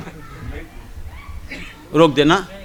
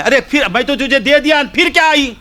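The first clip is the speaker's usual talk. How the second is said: raised, mildly puzzled saint; a hint of the saint; 9 semitones higher, faster than usual. Saint asking the woman why she returned, mildly puzzled. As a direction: ask why she came back again.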